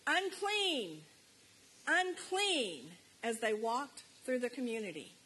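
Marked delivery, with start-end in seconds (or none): 0.1-2.9 higher, drawn-out cry of warning; retold loud shout. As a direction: call it out like a leper warning the village. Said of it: "to shout in a loud voice".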